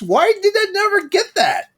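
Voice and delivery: high-pitched incredulous outburst; astonished disbelief. Real, not acted. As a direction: blurt it out high, amazed at yourself. Astonished at a cast list discovery.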